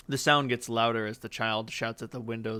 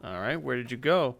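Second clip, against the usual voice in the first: normal talking voice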